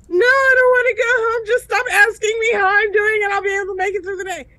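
high, loud blubbering rush; hammed-up bawling refusal, racing at the end; imitated wailing protest. Crying worker refusing to go home, retold wailing plea.

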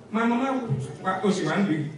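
ordinary talk

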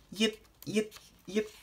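squeaky little puppy yips. Acted. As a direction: yip like newborn puppies. Puppies yip in picture book.

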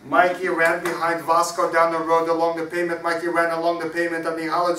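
loud rhythmic recital; energetic story narration. A narrator's reading. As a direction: drive the story along loudly. Reads story aloud at full voice.

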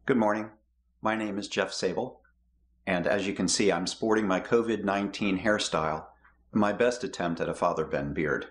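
ordinary delivery

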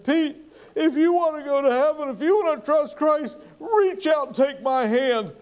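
high, pleading prayer voice, lightly retold; earnest plea. Preacher retelling his prayer for Pete, high earnest plea. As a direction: beg him gently to take your hand.

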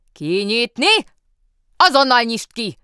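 loud, high shout through a door; yelling from inside. A narrator's reading. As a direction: bellow from behind the locked cell. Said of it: "üvöltözött odabentről".

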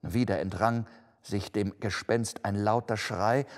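plain reading voice

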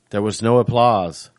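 plain reading voice

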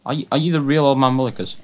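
ordinary talk, nothing marked

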